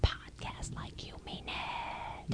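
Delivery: slow whispered motivational slogan. Pretend. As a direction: breathe it slowly like a mantra.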